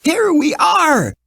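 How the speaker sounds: high, slow, happy call; excited cry. A narrator's reading. Dorothy arrives at the palace and calls out, high and excited. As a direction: announce your arrival with delight. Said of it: "cried Dorothy, jumping down".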